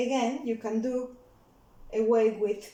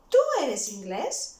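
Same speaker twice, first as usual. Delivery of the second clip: "Tú eres inglés" louder, dipping then rising at the end; question tone; 5 dB louder than usual. Shown as a demonstration. Tutor asking as somebody would ask the learner, question tone. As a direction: ask "Tú eres inglés" with a clear final rise. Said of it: "those question marks are very important".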